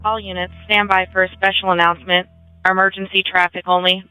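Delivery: crisp dispatcher over the radio; radio dispatch call; terse radio update. Real, not acted.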